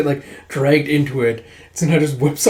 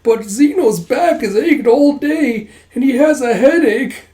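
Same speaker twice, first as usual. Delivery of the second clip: much higher, slow, creaky put-on old voice; fake frail old man; 10 semitones higher, 5 dB louder, slower than usual. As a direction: ham up a whiny elderly act. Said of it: "starts leaning on a cane".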